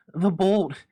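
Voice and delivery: loud, high straining shout; loud cry. Acted. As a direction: shout up the stairs for help. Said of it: "she cried loudly".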